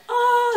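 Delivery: high, loud cry of pain, acted out; mock scream. Patient acting out the burning IV, mock scream. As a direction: let out an exaggerated high yelp. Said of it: "like, screaming".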